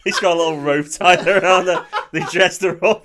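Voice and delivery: loud, laughing through the words. Real, not acted. Cohost jokes about bait for the aliens, laughing loudly. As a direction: crack up while describing it.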